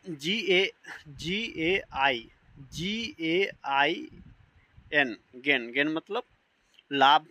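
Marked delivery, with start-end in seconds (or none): 0.1-5.2 slow letter-by-letter spelling. Shown as a demonstration. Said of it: "teacher spells out gain slowly".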